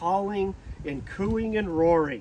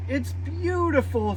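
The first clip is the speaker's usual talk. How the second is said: gleeful shout; 7 semitones higher than usual. A narrator's reading. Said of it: "Fiona shouted with glee".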